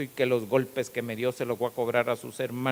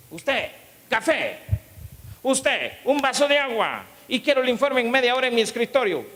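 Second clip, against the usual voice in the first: much higher, louder tyrant boss commanding; bossy barked orders; 10 semitones higher, 8 dB louder than usual. Retold or imitated. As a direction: bark orders like an angry boss. Boss barking orders at staff. (jefe tirano gritando órdenes, mucho más agudo y fuerte)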